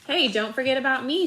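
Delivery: a loud call from Amy, fairly high; Amy shouts so she isn't forgotten; shouted. A narrator's reading. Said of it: "shouted Amy".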